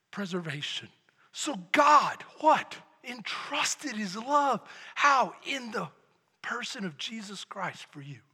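normal talking voice

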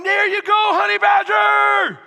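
high, loud, drawn-out cheer; dad cheering his son on the field; sideline cheer. Retold or imitated. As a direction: yell it across the field.

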